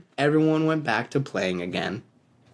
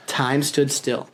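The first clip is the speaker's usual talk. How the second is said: ordinary delivery, nothing marked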